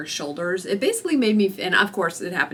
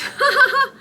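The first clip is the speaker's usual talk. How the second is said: high, loud burst of disbelieving laughter; incredulous laugh; 7 dB louder than usual. Real, not acted. Patient hearing the nurse's flashlight excuse, high incredulous laugh.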